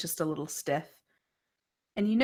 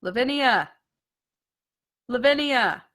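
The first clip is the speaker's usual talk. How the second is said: loud, high calling of a name; a familiar voice calling out loudly; 6 semitones higher, 9 dB louder than usual. Acted. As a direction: call the name from far away. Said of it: "calling out to you".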